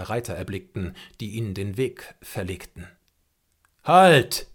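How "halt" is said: loud, high commanding halt; loud command to the warriors; shout. A narrator's reading. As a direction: stop the riders with one ringing word. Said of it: "rief ihnen Old Shatterhand zu".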